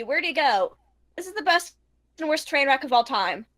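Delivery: ordinary talk, nothing marked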